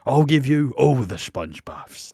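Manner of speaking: slow, lightly put-on tough soap voice; terrible impression. Grant promising Nigel sponge baths, badly faked impression. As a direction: stretch the words in a half-hearted character voice. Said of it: "It's a terrible impression".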